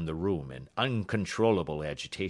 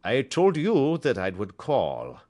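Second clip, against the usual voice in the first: put-on a slow, heavy German accent; the count announcing himself in a marked German accent; 7 semitones higher, 9 dB louder than usual. A narrator's reading. Visitor greets Holmes and Watson in a German accent. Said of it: "with a deep, harsh voice and a strongly marked German accent".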